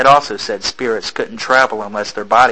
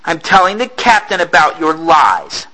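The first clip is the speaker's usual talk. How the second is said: defiant shout; 6 semitones higher than usual. Acted. Issei defiant retort.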